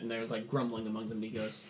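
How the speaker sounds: usual speaking voice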